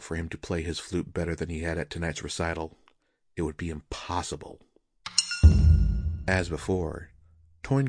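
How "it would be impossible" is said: ordinary delivery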